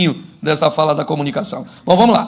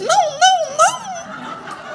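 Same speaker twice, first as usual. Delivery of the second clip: very high squealed string of no's; comic high, repeated no; high-pitched refusal; much slower than usual. Acted. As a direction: squeal the refusal far above your voice.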